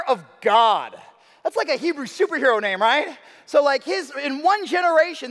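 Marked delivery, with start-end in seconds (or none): none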